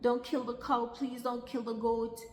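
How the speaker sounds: high, urgent pleading; retold begging.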